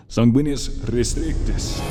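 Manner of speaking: sorcerer's booming, drawn-out spell; casting a spell. Acted. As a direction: ring out the magic words with command. Casts the binding spell.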